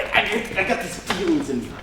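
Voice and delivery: usual speaking voice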